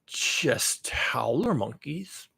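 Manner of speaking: slow breathy whisper; hushed mocking whisper of howler monkeys. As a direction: whisper it slowly, like a secret. Whispered dismissive aside.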